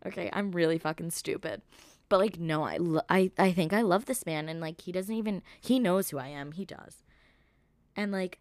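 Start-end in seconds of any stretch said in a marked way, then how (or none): none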